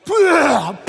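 loud mock retch into the regulator; retching sound. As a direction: spit it out like throwing up underwater. Imitating vomiting again.

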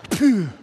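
exhale puff. Retold or imitated. Exhaling through regulator.

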